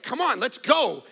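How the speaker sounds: ordinary delivery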